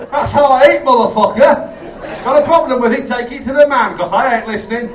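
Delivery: loud, cocky defiance at the audience; mock defiance. Comic defending how he eats, loud and cocky. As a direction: swagger it out, daring anyone to object.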